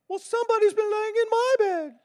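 very high put-on mama bear voice; squeaky-high bear mother.

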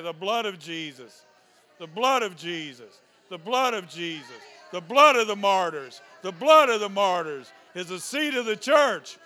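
building from firm to shouted; preaching at full force. Real, not acted. Pastor crying out to the church, building to a shout. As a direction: cry out the blood, rising each time.

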